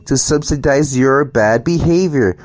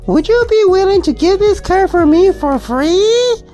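mock single mother. Single mother begging for a free car, mocked in high whiny falsetto. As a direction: whine the plea in a sneering high voice. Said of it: "this bitch gives you this stupid shit".

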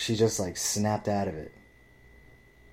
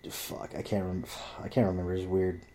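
normal talking voice

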